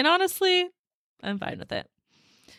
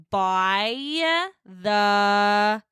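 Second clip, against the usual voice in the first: every word stretched very slow; drawn-out annoyed; much slower than usual. Retold or imitated. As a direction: spell it out slowly to show you're fed up. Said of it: "B Y Y Y Y Y Y".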